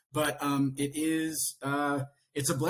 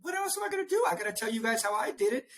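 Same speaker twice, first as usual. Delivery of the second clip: mock exasperated reply; 8 semitones higher, faster than usual. Retells his own reply, defensive.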